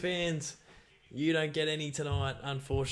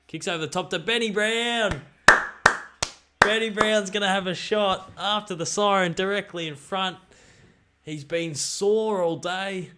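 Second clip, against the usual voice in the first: rising live play-by-play call; excited commentary; 5 semitones higher, 7 dB louder than usual. Real, not acted. Calling the final kick live.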